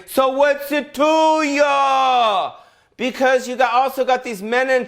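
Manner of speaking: high, very slow mock tough-guy voice; tough guy retort. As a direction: drag it out like a sneering gym bro. Gym guy talks back.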